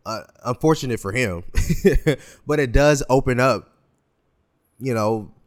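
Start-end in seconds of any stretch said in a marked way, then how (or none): 1.5-2.2 quick, light laugh; small chuckle after an awkward remark; short chuckle. Real, not acted. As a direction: let a brief chuckle slip out. Chuckles after unfortunate for him.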